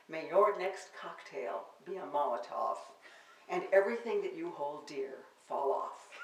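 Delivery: measured, performed poem recital; poetry reading. Acted. Poet reading her sonnets to the audience, performed recital. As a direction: read each line to the audience like a performed poem.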